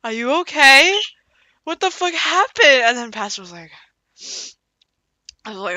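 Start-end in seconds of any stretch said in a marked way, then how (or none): 0.0-2.8 higher, slower concerned voice; a lightly voiced worried kid; worried friend. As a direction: ask gently but alarmed. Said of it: "May's like".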